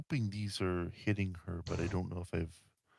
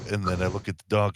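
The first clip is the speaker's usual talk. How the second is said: usual speaking voice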